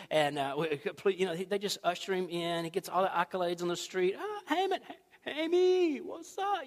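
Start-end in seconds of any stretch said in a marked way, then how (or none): 4.2-6.6 much higher, gushing fan voice; high fawning crowd voice; fawning greeting. Retold or imitated. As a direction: gush over him like a starstruck admirer. Townsfolk fawning over Haman.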